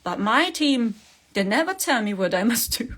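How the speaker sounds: usual speaking voice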